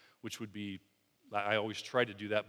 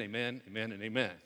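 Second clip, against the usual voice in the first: amen dropped much softer and lower; preacher echoing the amens, softer and fading; 3 semitones lower, 5 dB quieter than usual. Acted. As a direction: let the amens fall away quietly.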